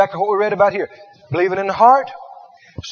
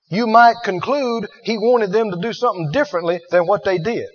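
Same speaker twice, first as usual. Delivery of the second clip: ordinary delivery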